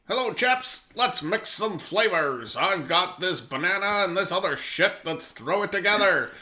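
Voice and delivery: put-on high, posh-old-timey 1950s gent voice; old-timey gentleman. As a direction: ham up a fifties gent mixing flavors. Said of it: "You think that's how they were talking in the fifties?".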